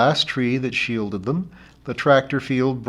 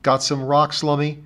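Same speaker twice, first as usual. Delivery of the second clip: ordinary delivery, nothing marked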